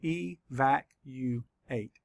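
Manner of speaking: slow, each syllable pulled apart. Shown as a demonstration. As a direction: sound out each syllable slowly. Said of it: "Let's count the syllables".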